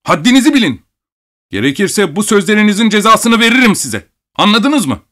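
high, threatening outburst; angry shout. A narrator's reading. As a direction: warn him off in a shrill yell. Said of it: "diye bağırdı".

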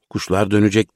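ordinary delivery, nothing marked

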